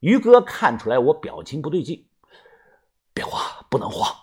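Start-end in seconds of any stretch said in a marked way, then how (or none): 3.1-4.1 soft whisper to himself; quiet unvoiced self-reassurance; calming himself. A narrator's reading. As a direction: hush your own panic. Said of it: "我安慰着自己".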